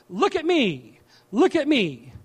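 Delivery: lightly voiced show-off; boastful peacock. Preacher plays the peacock showing off its feathers, boastful. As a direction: preen a little, proudly. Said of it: "the peacock, and it shows off all of its colorful feathers".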